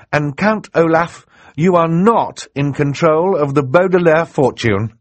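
high, loud, emphatic pronouncement; triumphant ruling. Acted. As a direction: deliver the verdict, punching "not". Judge triumphantly declares the marriage invalid.

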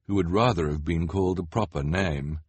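usual speaking voice

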